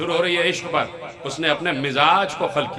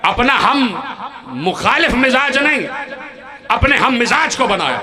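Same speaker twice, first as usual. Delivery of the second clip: raised, higher voice calling the hall to attention; fervent preaching; 7 semitones higher, 3 dB louder than usual. Real, not acted. Preacher rousing the congregation, raised fervent voice. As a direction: proclaim it to the gathering.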